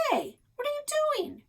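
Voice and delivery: high yell from up the tree. A narrator's reading. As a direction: call out in alarm from above. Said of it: "I heard Alex yell".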